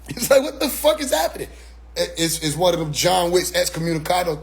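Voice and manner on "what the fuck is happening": high-pitched comic disbelief; acted high, incredulous question; bewildered. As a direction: squeak it out, totally baffled. Acting bewildered at being shunned.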